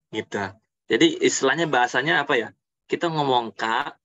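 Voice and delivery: ordinary delivery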